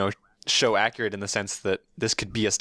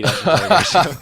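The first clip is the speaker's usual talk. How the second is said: loud, laughing announcement; 5 semitones higher, 9 dB louder than usual. Real, not acted. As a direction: laugh out the show title. Reads a joke title, laughing.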